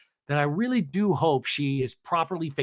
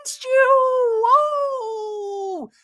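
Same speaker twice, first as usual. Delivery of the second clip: very high, loud mock squeal; hammed-up overexcited hello; gushing greeting; 6 dB louder, much slower than usual. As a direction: squeal it out, stretched long. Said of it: "and she goes".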